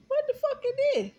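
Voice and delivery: shocked outburst. Retold or imitated. Seeing the blob in her sandwich, shrieking in disgust.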